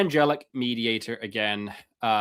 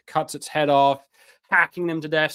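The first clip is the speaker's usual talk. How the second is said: ordinary talk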